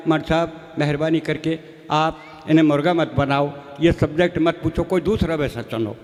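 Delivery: a hint of a humble father pleading.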